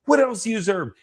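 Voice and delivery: high, pressing coach's question; retold rallying challenge; intense, assertive. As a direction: demand an answer to spur them on. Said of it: "way more intense and way more loud".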